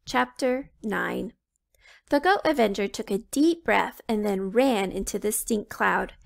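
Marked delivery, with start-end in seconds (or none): none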